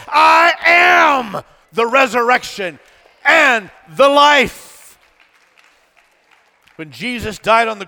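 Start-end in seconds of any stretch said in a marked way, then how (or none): 0.1-5.0 high, loud, drawn-out I AM; thundered declaration. Acted. Preacher correcting what Jesus said, thundered declaration. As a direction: shout it slowly, stretching each key word.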